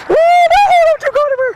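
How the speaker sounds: panicked high-pitched cry; high shriek. Real, not acted. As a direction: shriek it out in alarm. Shrieking at drifting kayak.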